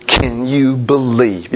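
slow, low head-shaking disbelief; mock disbelief. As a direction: drag it out, tut-tutting. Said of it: "shake my head and go".

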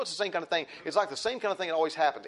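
normal talking voice

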